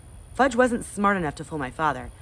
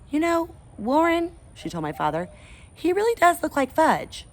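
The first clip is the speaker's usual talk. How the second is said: mother's voice; 12 semitones higher than usual. Acted.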